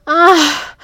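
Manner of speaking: high, loud, drawn-out cry; soprano scream. A narrator's reading. Woman at the peak of feeling, soprano scream. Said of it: "I screamed like a soprano".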